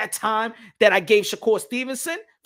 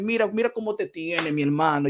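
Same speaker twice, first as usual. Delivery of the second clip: normal talking voice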